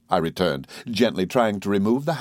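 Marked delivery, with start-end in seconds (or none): none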